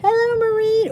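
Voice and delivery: bright, high, loud hello; excited greeting. Real, not acted. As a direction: call out a happy welcome. Greeting a viewer in chat.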